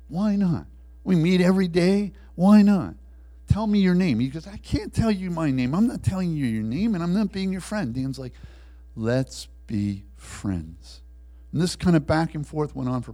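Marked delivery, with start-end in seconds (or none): 0.1-4.2 loud, high, pushy questioning; insistent pestering. Acted. Prisoner presses his reluctant jailer for his name, loudly insistent. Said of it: "Dan's insistent". 4.5-7.9 high-pitched, protesting refusal; adamant refusal. Acted. Jailer refuses the pushy prisoner, adamant. 9.0-11.0 very slow, word-by-word insistence; insistent. Acted. Prisoner will not drop his offer to the jailer, slowly insistent. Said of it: "Dan's insistent".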